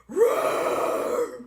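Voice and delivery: loud breathy roar to scare a bear; mock scary roar. Wife behind husband scaring bear, mock roar. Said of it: "try to be big and scary".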